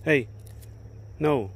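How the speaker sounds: a sudden loud scolding no; sharp loud no. Real, not acted. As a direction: stop the dog cold with one word. Dog wrecking things.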